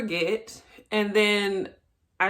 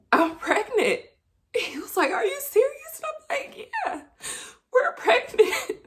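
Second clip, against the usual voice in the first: high, overcome with joy; choked-up announcement, pitch climbing high; happy near-tears; 13 semitones higher than usual. Real, not acted. Woman tells boyfriend she's pregnant, high and tearfully happy. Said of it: "I can't believe I'm about to cry".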